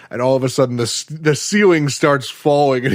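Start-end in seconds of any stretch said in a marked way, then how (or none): none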